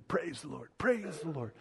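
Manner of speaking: very soft, quick murmur of praise; hushed praise. Acted. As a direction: drop right down, as if praising under your breath. Voicing worshippers' repeated praise.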